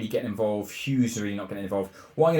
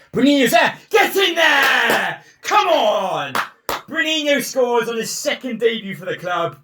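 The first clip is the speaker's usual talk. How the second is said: much higher, much louder, stretched-out roar; wild goal celebration; 13 semitones higher, 8 dB louder than usual. Real, not acted. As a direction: scream with joy at the winner.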